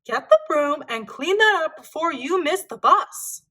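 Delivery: mild mock scolding. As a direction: tell him off like a fed-up mother. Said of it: "scolded Mom".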